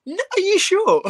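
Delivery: very high squeaky disbelief; mock shocked high voice. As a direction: squeal it like you can't believe your eyes. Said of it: "I went".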